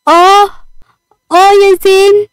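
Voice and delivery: high, loud cry of pain. A narrator's reading. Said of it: "فصرخت بتعب". (صرخة وجع وتعب)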